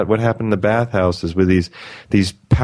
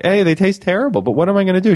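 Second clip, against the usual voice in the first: imitating the woman; 9 semitones higher, faster than usual. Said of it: "And the woman was like".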